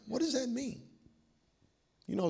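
ordinary talk, nothing marked

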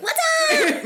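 high, loud "what's up" squeal; friend's wired shriek, imitated; imitating a hyped greeting. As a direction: burst in with a mock-manic greeting. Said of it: "she was like".

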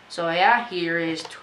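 ordinary delivery

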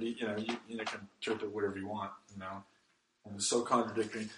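low, soft, hurried mock objection; dismissive objector. Preacher mimicking people who doubt the Bible, dismissive. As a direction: toss it off quickly under the voice.

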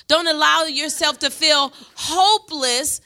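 rally the men from the pulpit; preaching at full force. Acted. Pastor to the men of the church, rousing preaching.

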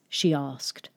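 ordinary delivery, nothing marked

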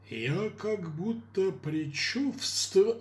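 slow drawn-out foreboding; hammy ominous line; ominous actor. Acted. Mayor continuing role.

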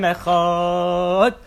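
lightly drawn-out Shema, stretching the last word. Retold or imitated. Rabbi Akiva stretching out the Shema as he dies, drawn out. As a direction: hold Echad out as if with a final breath. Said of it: "stretched out the word Shema Yisrael".

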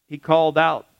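ordinary talk

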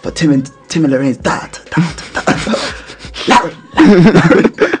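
imitating an echoing mic announcer. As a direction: let each repeat fade like an echo.

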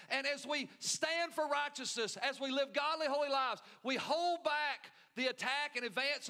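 preaching at full force. Acted. Pastor urges the church to win souls, fervent preaching. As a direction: drive it home to the congregation. Preaching builds after repeat.

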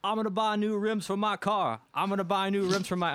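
high-pitched mock Polish accent. Polish center announcing his winnings' use, put-on Polish accent. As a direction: imitate a Polish athlete, pitched well up. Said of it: "in his Polish accent".

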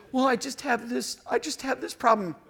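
small, high put-on voice; meek caller. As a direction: plead timidly, playing the cowed friend. Acting timid caller to gruff friend.

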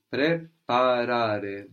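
very slow, each syllable separated. Shown as a demonstration. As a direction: break it into syllables for the learner. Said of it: "So take it syllable by syllable".

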